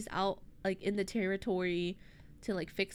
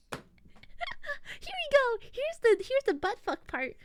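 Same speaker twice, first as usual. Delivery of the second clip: very high, laughing build-up; giggly excitement. Real, not acted. Narrator laughs before the cabin scene, very high and giggly. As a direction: giggle into the naughty part. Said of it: "laughs at own story".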